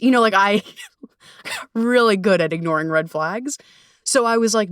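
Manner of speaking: usual speaking voice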